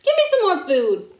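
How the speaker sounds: lightly voiced greedy ask; greedy guest. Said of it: "you're saying".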